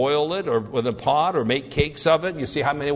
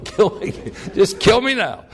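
mock despair; 4 semitones higher, 3 dB louder than usual. Repeats Moses mockingly.

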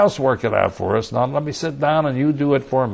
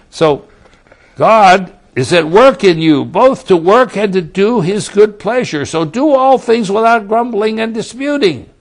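loud, slow, soaring pulpit delivery, higher and louder; full-force preaching; 4 semitones higher, 7 dB louder, slower than usual. Acted. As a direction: proclaim it to the whole hall. Returns to scripture at full force.

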